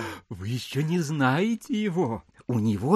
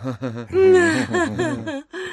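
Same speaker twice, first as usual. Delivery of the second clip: high tender laugh of delight; proud laugh; 12 semitones higher than usual. Acted. Manilov laughing high with pride at his son.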